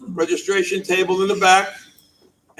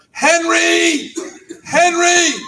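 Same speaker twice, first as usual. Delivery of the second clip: loud, high call across the room, twice; yelling a name; 12 semitones higher, 4 dB louder, much slower than usual. Retold or imitated. Woman at the luncheon calling her helper, loud yell. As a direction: shout the name over the crowd, much higher. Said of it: "she yells out".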